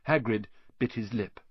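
ordinary delivery, nothing marked

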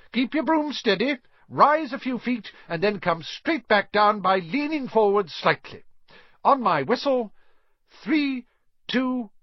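high-pitched commanding teacher; high, projected instructions; brisk flying instructor. A narrator's reading.

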